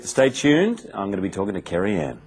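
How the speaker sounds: ordinary talk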